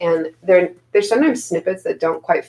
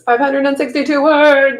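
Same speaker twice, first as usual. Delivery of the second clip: higher, louder cheer; excited; 8 semitones higher, 4 dB louder than usual. Retold or imitated. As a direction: read it like big news. Reading an excited chat message.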